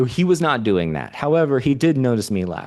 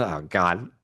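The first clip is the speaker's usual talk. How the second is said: ordinary talk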